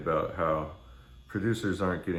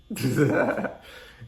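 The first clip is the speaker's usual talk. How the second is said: a short burst of real laughter, higher and louder; 7 semitones higher, 4 dB louder than usual. Real, not acted. Vlogger laughing at his own joke about taking tapes, real laughter. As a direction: let a genuine laugh break out at your own joke. Laughs after mocking the internet.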